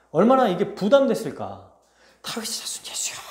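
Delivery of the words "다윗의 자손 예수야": hushed, whispered mock shout; whispery imitation of a desperate call; crying out. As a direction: imitate a distant cry under your breath. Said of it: "이렇게 외치는데".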